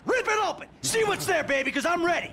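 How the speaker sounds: high-pitched, furious dare; defiant shout. Acted. Daring the friend to rip it open, defiant. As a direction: goad him to go ahead. Acted quarrel, daring him to attack.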